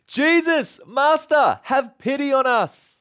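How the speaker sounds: high, loud desperate cry for mercy; called out loudly. Acted. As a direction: call out from a distance, pleading. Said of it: "called out in a loud voice". (lepers calling to Jesus from afar, crying out loud)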